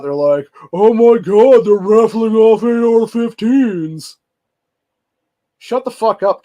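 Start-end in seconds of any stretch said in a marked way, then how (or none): 0.7-4.2 loud, high, drawn-out mock panic; mock freak-out. Host mocking people freaking out over the gun raffle, mock panic. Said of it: "you had people freaking out". 5.6-6.4 loud, high dismissive outburst; angry retort. Acted. Host telling off anti-gun complainers, angry and loud. Snaps back at mocked complainers.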